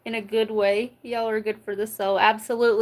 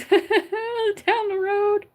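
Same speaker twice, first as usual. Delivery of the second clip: high, slow, breaking into laughter; laughing; 11 semitones higher, much slower than usual. Real, not acted. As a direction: laugh through the end. Cracks up mid-sentence.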